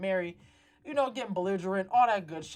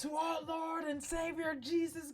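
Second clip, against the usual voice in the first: higher, slow, proclaiming toast; hammed-up righteous toast to the room; pious toast; 8 semitones higher, slower than usual. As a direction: raise the glass and preach it slowly. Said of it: "starts to do a toast".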